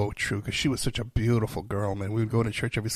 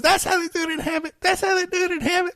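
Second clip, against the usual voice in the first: put-on high, sing-song drunken catchphrase; imitating Holly; faster than usual. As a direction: mimic her favorite line, way up high. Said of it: "her thing was always".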